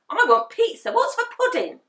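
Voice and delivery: put-on high, loud whiny child; bratty demand. A narrator's reading. Henry demanding pizza from Dad, loud and bratty. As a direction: demand it like a spoiled boy. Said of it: "said Horrid Henry".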